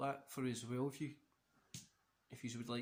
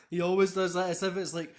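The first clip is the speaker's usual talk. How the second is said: amused; 5 semitones higher, 10 dB louder than usual. Real, not acted. Amused commentary on wrestler's habit.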